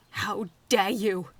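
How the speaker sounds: loud, slow furious cry; enraged. A narrator's reading. Robot Snow raging at Similidon, loud and slow. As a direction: spit it out in rage. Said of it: "Snow cried out ... enraged".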